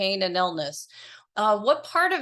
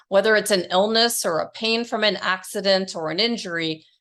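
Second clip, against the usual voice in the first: polished, louder announcer-style intro; podcast intro read; 5 dB louder than usual. Acted. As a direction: read it like a show opener. Host reads podcast intro.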